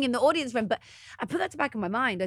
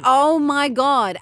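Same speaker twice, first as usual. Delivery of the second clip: slow, loud, high mock exasperation; retold exasperation; 4 semitones higher, 8 dB louder, much slower than usual. Woman retells reacting to his social media excuse, exasperated. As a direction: drag out each word, exasperated.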